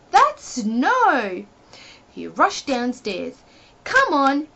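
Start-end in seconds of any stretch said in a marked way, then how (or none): 0.1-1.5 excited. A narrator's reading. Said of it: "cried Mister Silly". 3.8-4.5 higher, louder call. A narrator's reading. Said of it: "he called".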